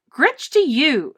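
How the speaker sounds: loud, slow, defiant retort; boy snapping back at the nizzards. Acted. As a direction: throw the word right back at them. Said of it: "snapped back Eric".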